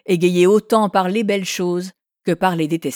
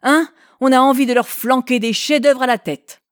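louder, higher, fed up with the crowd; exasperated outburst; 5 semitones higher, 4 dB louder than usual. A narrator's reading. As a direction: blurt it out in disgust. Claude exaspéré contre la foule. (éclat exaspéré, plus fort et plus aigu)

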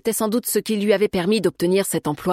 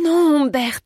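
high, loud, alarmed plea; urgent cry of protest; imploring; 9 semitones higher, 5 dB louder, slower than usual. A narrator's reading. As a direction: beg him to stop. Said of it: "l'implora-t-elle".

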